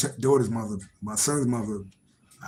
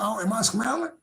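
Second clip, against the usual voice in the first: much higher, giggly teasing questions; playful teasing; 9 semitones higher than usual. Real, not acted. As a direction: tease yourself in a high sing-song. Teasing himself over his reaction.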